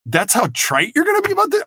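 high mock outrage at the coach; indignant disbelief. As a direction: pitch it up, offended and incredulous. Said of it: "I'm like".

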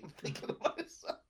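ordinary delivery

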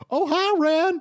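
high, loud cheery robot greeting; chirpy animatronic hello. Acted. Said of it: "they're kinda just like".

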